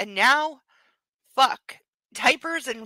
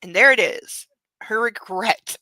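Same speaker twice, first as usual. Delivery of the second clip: ordinary delivery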